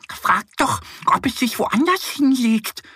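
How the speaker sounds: high duck voice offering an idea; suggesting duck. Acted. Duck Annie suggests asking the boar to move, high. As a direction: lift it up, helpful. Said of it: "schlägt Annie vor".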